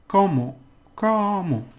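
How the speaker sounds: "Como" with a high first syllable, falling away; stress on CO. Shown as a demonstration. Teacher shows learners the word, first syllable of "Como" stressed. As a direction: lift the CO of "Como" and drop the ending. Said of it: "Right, you have to do this".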